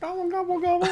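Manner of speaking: high squeaky turkey gobbling; turkey gobble. Pretend. Turkey joke about Thanksgiving.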